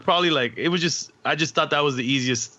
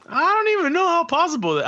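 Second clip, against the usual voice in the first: incredulous. Real, not acted. Disbelief at one-arm DJing.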